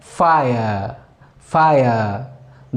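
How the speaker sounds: फायर drawn out slowly as faa-uh; slow careful pronunciation. Shown as a demonstration. Teacher modelling fire for students, slow. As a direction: stretch फायर with no y sound. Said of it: "शब्द हम देखते हैं".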